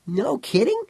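put-on high, suddenly interested child; brightened boy. A narrator's reading. Boy suddenly interested in what Eng says, brightened and high. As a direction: pitch it way up, a boy newly intrigued. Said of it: "The boy brightened".

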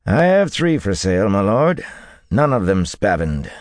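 dealer's high, forward sales patter; wiry horse dealer. A narrator's reading. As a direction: pitch your wares to a lord. Horse dealer replies to buyer.

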